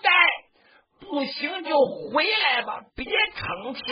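high cry of brother, easing down; a worried elder cousin calling across the field; calling out from behind. Acted. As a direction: call out anxiously from the back lines. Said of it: "在后面就大喊一声".

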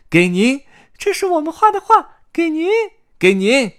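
very high, loud chick voices; eager little chicks. A narrator's reading. Carmen and Carmelito give Grandpa their drawings, piping up eagerly. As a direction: pipe up eagerly, offering a gift.

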